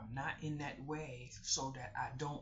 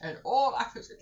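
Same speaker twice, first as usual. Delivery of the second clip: near tears; 8 semitones higher, 11 dB louder, faster than usual. Real, not acted. Emotional retelling of breakdown.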